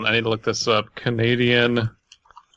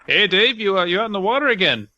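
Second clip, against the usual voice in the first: calling out to Dave; 8 semitones higher, faster than usual. Acted. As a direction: shout a cheery hello out to sea. Voicing a caller to Dave.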